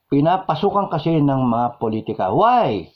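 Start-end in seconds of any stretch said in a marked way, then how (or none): none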